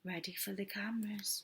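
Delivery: unvoiced secret whisper. A narrator's reading. Narrator whispering a question to the listeners. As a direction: lean in and whisper.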